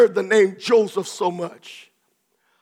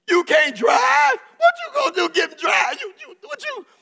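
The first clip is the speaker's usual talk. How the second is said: mock scolding, pitched way up and shouted; sassy midwife. Preacher teasing a brother as a bossy midwife, mocking shout. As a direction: tease him like a pushy midwife. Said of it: "sometimes midwives get a little bit".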